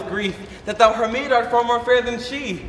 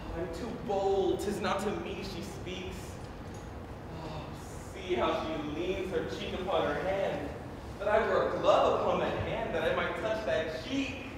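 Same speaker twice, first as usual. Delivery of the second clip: much softer, longing murmur; hushed aside; 10 dB quieter than usual. Acted. Romeo whispering aside unseen.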